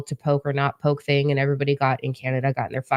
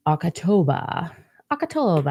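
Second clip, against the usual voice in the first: silly voice; slower than usual. Real, not acted. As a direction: say it in a goofy odd voice. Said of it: "don't know what that was".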